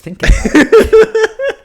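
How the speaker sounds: laughing, mock tearful; choked up. Real, not acted. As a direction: laugh through fake tears. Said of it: "getting choked up".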